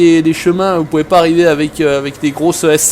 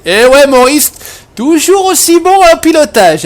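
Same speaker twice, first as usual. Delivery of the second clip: mock praise; 10 semitones higher, 7 dB louder than usual. Teasing Maurice about bad driving.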